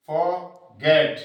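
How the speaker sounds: फॉरगेट with the R dropped, drawn out in two parts; silent R. Shown as a demonstration. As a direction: say फॉरगेट slowly as "faw-get", no R sound. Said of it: "तो रे नहीं बोलेगा". (फॉरगेट, र का उच्चारण किए बिना)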